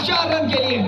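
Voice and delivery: high shout for a boundary; excited cricket commentary. Real, not acted. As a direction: call out the four. Boundary called.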